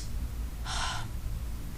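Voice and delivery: long, breathy sigh of despair; weary sigh; exasperated sigh. Real, not acted. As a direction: sigh out the frustration. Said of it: "exasperated sigh".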